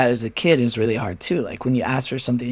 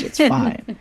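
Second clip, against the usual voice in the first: friends reassuring; 11 semitones higher than usual. Retold or imitated. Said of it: "And they're like".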